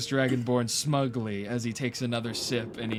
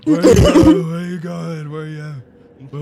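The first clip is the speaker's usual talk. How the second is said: calling out; 5 semitones higher, 15 dB louder than usual. Pretend. Calling after drunken character.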